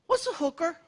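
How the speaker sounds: put-on high innocent kid asking. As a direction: play the curious little boy. Son voiced asking question.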